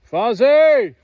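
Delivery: very loud call with a falling end; calling loudly. Real, not acted. Owner calling crying Fozzie, very loud. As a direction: yell the name out to the far field.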